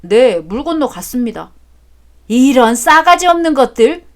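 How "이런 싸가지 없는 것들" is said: loud scornful curse from the father; angry insult. Acted. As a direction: spit out the insult at rude children.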